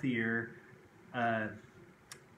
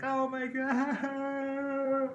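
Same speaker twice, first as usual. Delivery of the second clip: much higher, very slow mock wail; imitating bawling; 13 semitones higher, much slower than usual. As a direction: wail it out like a scared four-year-old. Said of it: "I started bawling in front of her".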